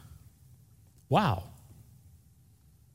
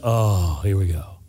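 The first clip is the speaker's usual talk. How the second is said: weary sigh; 7 semitones lower, slower than usual. Retold or imitated. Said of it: "Instead of".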